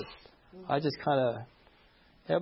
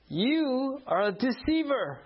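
raised, slow, accusing; mock accusation; 8 semitones higher, slower than usual. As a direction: drag out each word like an accusation. Angry church member accusing preacher.

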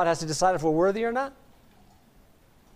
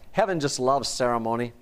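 ordinary talk

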